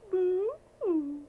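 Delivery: high, loud hammed-up boo-hoo; fake-sob like a little cub; hammed-up baby bear sob; mock crying. Baby Bear cries over chair.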